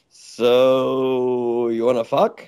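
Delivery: very slow, long drawn-out "So"; retold blunt proposition. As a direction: stretch out a mock come-on. Woman's blunt come-on, retold.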